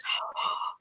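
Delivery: soft acted gasp; hushed intake of breath, aghast; shocked gasp. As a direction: draw in a small horrified breath. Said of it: "her whole face, like, goes".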